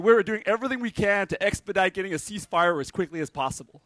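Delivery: mock American politician accent, smooth and official; lightly put-on American press statement voice. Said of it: "Is that a good American accent?".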